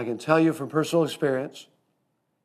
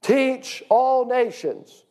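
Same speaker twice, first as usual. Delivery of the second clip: slow, raised, emphatic TEACH; stress on TEACH; 8 semitones higher, much slower than usual. Shown as a demonstration. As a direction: TEACH drawn out and high, all nations slow. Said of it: "what's the next word".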